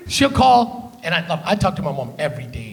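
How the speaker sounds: ordinary talk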